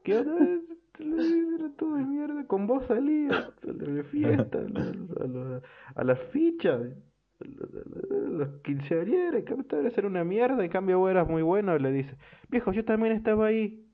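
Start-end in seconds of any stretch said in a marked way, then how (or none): 0.0-11.8 put-on very high, gushing drunk voice, laughing between lines; drunk sentimental gush. Drunk player tells his old rival he cried for him, high and gushing. Drunk protagonist rambling to teammate. 12.5-13.8 high, hurt protest; the friend squeaks that he was there too; lightly voiced indignant friend. Teammate complains to the drunk that he was invited too, mock hurt and high.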